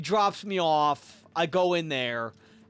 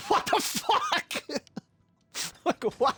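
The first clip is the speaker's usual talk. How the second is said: shocked squeal; 12 semitones higher than usual. Retold or imitated. Reacting to the doctor calling his dad, high squeal. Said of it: "I'm like".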